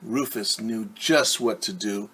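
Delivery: plain reading voice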